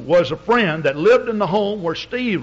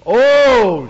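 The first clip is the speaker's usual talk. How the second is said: loud high wailing Oh; a sudden anguished Oh, much louder; anguished cry; 6 semitones higher, 10 dB louder than usual. Retold or imitated. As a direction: cry it out like a weeping woman. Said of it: "she started crying".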